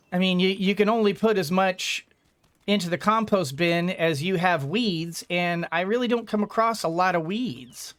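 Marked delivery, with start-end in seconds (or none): none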